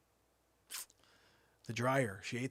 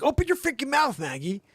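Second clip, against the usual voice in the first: very high, loud yelling command; shout; 13 dB louder than usual. Retold or imitated. Father ordering the dog to open its mouth, yelling. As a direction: bark it at the dog.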